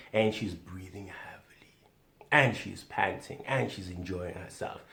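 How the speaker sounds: a hint of whispering; breathy hush. Shown as a demonstration. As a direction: hush it like a bedroom voice. Describing her breathing.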